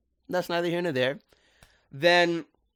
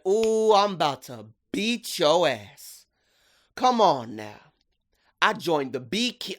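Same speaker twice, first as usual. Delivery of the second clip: tough mama threat. Retold or imitated. Mama Clawthorne threatening Wortlop in his cage, hammed up. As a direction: play the mom who'll beat you up. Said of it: "She said".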